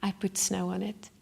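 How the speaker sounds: soft, gentle whisper of reassurance. Retold or imitated. Mountain guide reassuring the sick climber, gentle whisper. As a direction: hush it kindly, like comforting a friend. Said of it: "very gently and he said".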